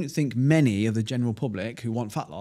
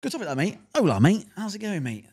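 lightly hammed-up "mate" voice, pitched higher; a playful jab at the in-joke, a touch higher; mock matey bloke; 6 semitones higher than usual. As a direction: tease the DM-slider lingo with a bit of lift. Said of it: "In joke".

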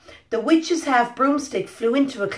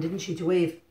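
usual speaking voice

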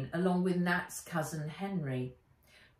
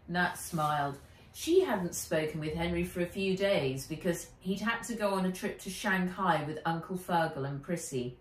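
plain reading voice

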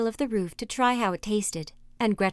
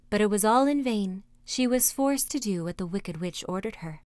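plain reading voice

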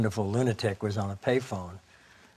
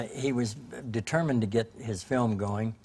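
usual speaking voice

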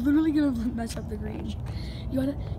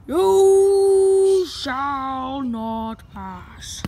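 mock movie wizard; 9 dB louder, much slower than usual. Blocking friend's golf shot.

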